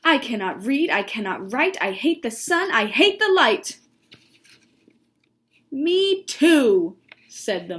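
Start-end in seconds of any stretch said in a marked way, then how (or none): 0.0-3.8 sing-song complaint climbing higher and louder; animated, exasperated mole character; storybook rant. Acted. Miz Mole rants on. 5.7-7.0 high, loud, drawn-out "too"; delighted storybook agreement; eager agreement. Acted. Mole eagerly agrees with her.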